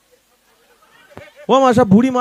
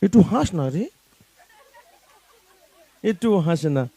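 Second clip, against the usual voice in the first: soft coaxing; 4 semitones lower, 9 dB quieter, much faster than usual. Real, not acted. Coaxing old woman to smile.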